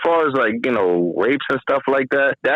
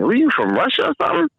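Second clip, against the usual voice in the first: curious question; 13 semitones higher, much faster than usual. Retold or imitated. Officer asks inmate, fast high.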